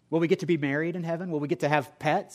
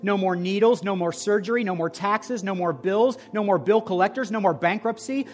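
louder and louder sermon list, hammering no more; building preaching; 5 semitones higher, 6 dB louder than usual. Acted. Pastor stirring the congregation about heaven, rising preaching. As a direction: let the fervour climb item by item.

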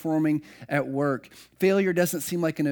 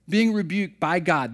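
ordinary delivery

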